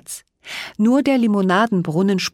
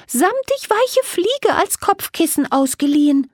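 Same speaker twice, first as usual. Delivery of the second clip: much higher, light fairy voice, hopeful; a small fairy explaining her trouble and asking for help; little fairy Millie. A narrator's reading. Fairy Millie pleads with the two girls for help.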